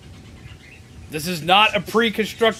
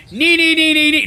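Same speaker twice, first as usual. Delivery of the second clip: put-on higher, louder childish nee nee; mocking taunt; 7 semitones higher, 4 dB louder than usual. Streamer taunting the commander, childish mockery. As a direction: taunt like a bratty kid.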